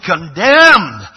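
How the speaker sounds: loud, emphatic pronouncement; one word, hammered out loud; thundered verdict. Acted. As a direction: boom it out as a damning verdict. Preacher voices God's written verdict.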